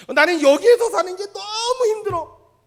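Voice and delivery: loud, high whine with a laugh; whiny complaint. Retold or imitated. Preacher voicing a person complaining about where they live. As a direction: moan about this place. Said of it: "힘이 든 사람은요".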